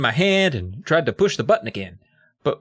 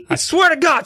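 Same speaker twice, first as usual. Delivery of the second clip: high, louder exasperation; exasperated; 4 dB louder than usual. Retold or imitated. Continued outburst.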